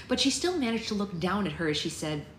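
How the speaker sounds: ordinary delivery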